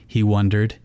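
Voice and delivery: ordinary delivery, nothing marked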